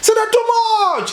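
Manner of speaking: high excited shout of praise. Retold or imitated. Urobo man praising the Indian doctors, high excited shout. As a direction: cry it out high in delight. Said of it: "He shouted again".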